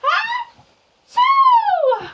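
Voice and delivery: loud, high, explosive "achoo"; huge mock sneeze. A narrator's reading. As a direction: blast out a storybook sneeze, long second half. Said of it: "He blows and he sneezes".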